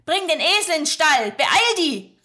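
loud, high, fast Swabian command; calling orders. Acted. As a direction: shout the order across the yard. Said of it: "rief sie Joshua zu". (Magda ruft laut und eilig)

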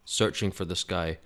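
ordinary delivery

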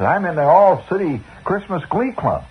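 put-on high, eager kid's announcement; excited boy. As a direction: burst with pride telling mom. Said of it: "I said".

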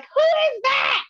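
drawn-out, high-pitched mock shout; nosy dad shouting. Daughter imitating dad interrupting her FaceTime, high mock shout. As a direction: imitate a demanding parent, loud and very high.

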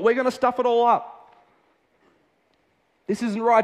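a frantic inner voice crying out that this is wrong; protesting inner voice. Retold or imitated. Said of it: "a voice inside me that'd be like".

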